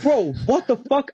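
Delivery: high, drawn-out mock shock; retold disbelief. Retelling his reaction to the sketchy box, mock disbelief. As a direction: stretch it out slow and high, lightly disbelieving.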